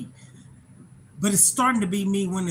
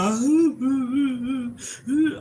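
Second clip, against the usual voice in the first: a real laugh that breaks into crying; laughing through real tears, voice catching high; tearful laugh-cry; 4 semitones higher, 5 dB louder than usual. Real, not acted. To her viewers while sharing something painful, laughing through tears. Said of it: "It's very emotional".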